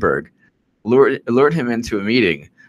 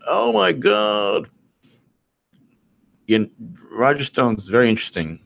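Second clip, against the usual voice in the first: mock Roger Stone. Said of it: "Roger Stone's like".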